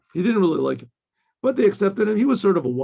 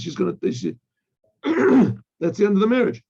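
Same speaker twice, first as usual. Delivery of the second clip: ordinary talk, nothing marked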